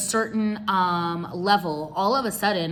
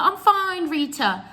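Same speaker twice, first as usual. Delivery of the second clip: mock uncle; 8 semitones higher, slower than usual. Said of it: "And he was like".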